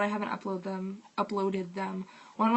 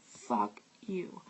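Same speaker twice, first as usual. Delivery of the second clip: muttered curse; 2 semitones lower, 8 dB quieter than usual. Retold or imitated. Muttered insult after quoted line.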